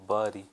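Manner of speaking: "bury" said as "berry", short e; "bury" with the vowel of "bed", not "bur"; teacher's pronunciation. Shown as a demonstration. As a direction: model "bury" slowly and clearly like "berry". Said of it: "इसको पढ़ते हैं".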